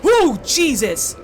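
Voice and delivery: loud, slow startled yell; startled yelp. Real, not acted. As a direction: yell out in real shock. Startled by a sudden game jump.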